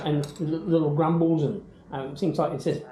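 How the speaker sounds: ordinary talk, nothing marked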